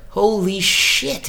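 drawn-out, high, awestruck exclamation; stunned realization. Acted. Viewer seeing an old scene pay off, stunned. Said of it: "you're like".

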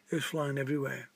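usual speaking voice

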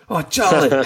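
high, teasing hail; hailing little Charlie. Retold or imitated. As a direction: call out fondly to a wee boy in Scots. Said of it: "talking in your Scottish accent to Charlie".

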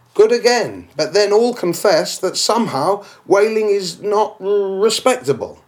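a disdainful imagined skeptic; objector's voice. Acted. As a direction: play the snobbish critic.

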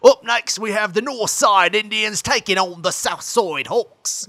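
loud, high, drawn-out mock Australian sportscast; Australian radio announcer. Shown as a demonstration. Auditioning for radio, hammed-up Australian announcer accent. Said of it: "talk like an Australian, I can get on the radio".

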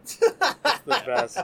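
slow, laughing words. Real, not acted. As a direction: drag it out through laughter. Laughing about being high.

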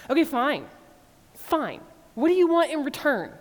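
mock exasperation, building; sarcastic. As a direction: people sarcastically answering God. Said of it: "increasingly sarcastic and exaggerated".

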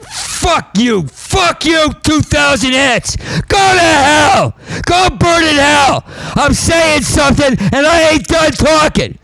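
very high, furious shout; screaming. Real, not acted. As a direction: scream it at them, hold nothing back. Screaming at the crowd.

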